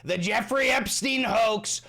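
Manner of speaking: high, drawn-out mock rant ending in a long holler; all-caps yelling. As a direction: yell it like an all-caps text. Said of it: "a spam text in all caps".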